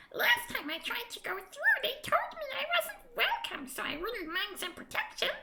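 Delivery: high squeaky sprite voice; a little fairy angling for help; tiny creature. Acted. Creature explains its plight.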